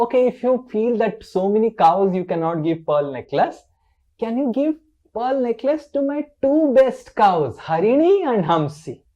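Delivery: high, loud, insistent pleading; Krishna. Acted. Krishna asks Lalita for pearl necklaces for two cows, pleading. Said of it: "then the Krishna said".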